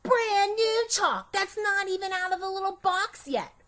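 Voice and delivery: thrilled. Acted. Girl admiring new chalk.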